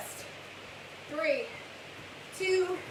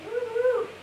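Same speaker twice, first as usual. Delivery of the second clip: excited cheer; 12 semitones higher, 4 dB louder than usual. Real, not acted. Cheering end of wall sit.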